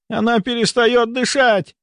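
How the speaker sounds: high-pitched urgent shout; alarmed cry. A narrator's reading. A colleague checks the patient's pulse and cries out, alarmed. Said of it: "крикнула Раиса Павловна".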